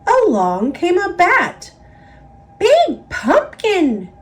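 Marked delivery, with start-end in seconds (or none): 2.6-4.1 squeaky high little bat; tiny bat marvelling, pitched high. A narrator's reading. Little bat admiring the pumpkin in a high voice. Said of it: "said the bat".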